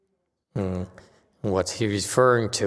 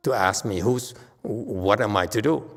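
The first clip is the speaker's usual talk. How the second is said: normal talking voice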